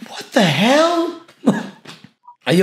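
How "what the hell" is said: high, stretched-out disbelief; drawn-out shock. Real, not acted. Streamer reacting to a caller, drawn-out shock. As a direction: draw it out long in high-pitched surprise.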